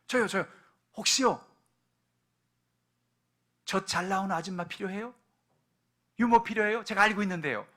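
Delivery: put-on excited young girl volunteering; eager calling out. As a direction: rush in and call out to the princess. Miriam runs up calling out.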